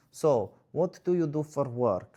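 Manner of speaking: put-on dull date guy in a Russian accent; mock Russian accent, deadpan. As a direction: bore her with a Russian accent. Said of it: "I don't know why this guy's Russian".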